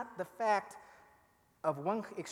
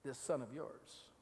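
lower, slow, scornful "son"; the elder son disowning his brother; sneering; 4 semitones lower, 7 dB quieter, slower than usual. Retold or imitated. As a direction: say it with cold disdain. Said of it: "Not my brother".

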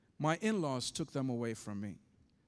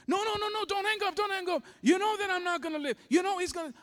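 much higher and louder, frantic; panicked begging; 7 dB louder, faster than usual. Retold or imitated. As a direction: plead so he won't hang up. Wife begs him not to hang.

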